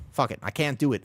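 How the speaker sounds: ordinary talk, nothing marked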